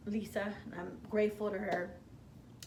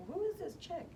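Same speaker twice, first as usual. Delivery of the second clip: higher, sneering mock voice; dismissive crowd; 9 semitones higher, 3 dB quieter than usual. Imagined dismissive audience.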